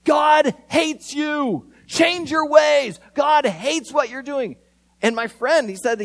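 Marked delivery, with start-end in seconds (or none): 0.0-4.5 slow, loud and high, hammering each word; yelling preacher. Retold or imitated. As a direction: bark it down a bullhorn at the crowd. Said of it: "they're yelling at people".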